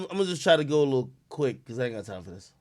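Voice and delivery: ordinary talk, nothing marked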